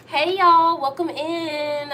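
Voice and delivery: bright, high welcome to viewers; excited greeting. Real, not acted. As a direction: call out a cheerful hello.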